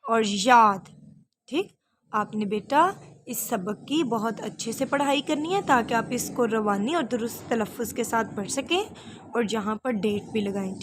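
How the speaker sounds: ordinary delivery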